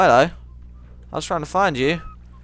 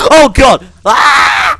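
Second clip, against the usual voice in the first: high, loud frightened shout; scared scream; 14 semitones higher, 18 dB louder, much slower than usual. Real, not acted.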